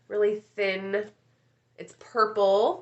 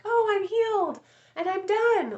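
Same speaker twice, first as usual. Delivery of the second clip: high mock voice of someone done healing; mock smug voice; 12 semitones higher than usual. Telling viewers healing never ends, mock high voice. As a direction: parody the smug healed person. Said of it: "we're never gonna be like".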